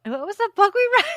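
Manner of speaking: high, loud laugh through the question; laughing embarrassed. Real, not acted. Host laughing at forgetting the book's title. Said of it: "God, this is so bad".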